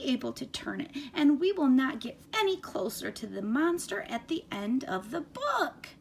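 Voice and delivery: high, crying Grover begging the reader to stop. Acted. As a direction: play Grover, frantic and upset that the page turned. Reads Grover's lines in character.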